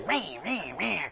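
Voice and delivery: wordless imitation of bickering; repeated falling cries, playfully imitated; mock squabbling noise. Speaker imitating two unspiritual women going at it, mock squabbling noise.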